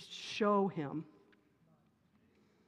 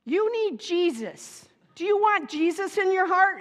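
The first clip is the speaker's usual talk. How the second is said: put-on high, loud earnest child voice; little boy; 11 semitones higher, 10 dB louder than usual. Her four-year-old son asks the tough coworker, earnest child voice. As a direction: play a small boy asking in all innocence. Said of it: "and he goes".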